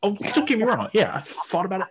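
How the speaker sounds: bursts out high and loud, owning it; excited admission. Real, not acted. Admitting to his cohost he considered it, excited and high. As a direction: blurt it out to the cohost, delighted.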